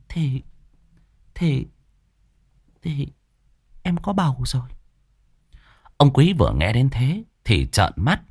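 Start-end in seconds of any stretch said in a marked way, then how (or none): 0.1-4.7 soft, stammering, higher wife voice; hesitant confession. Acted. Wife shyly tells husband she is pregnant, soft stammer. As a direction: stammer it out quietly, embarrassed.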